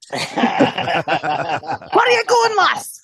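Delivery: slow, hammed-up Scottish accent; mock Scottish burr; Scottish Lyft driver. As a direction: play a Scottish cabbie greeting a passenger. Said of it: "love to have a Scottish Lyft driver".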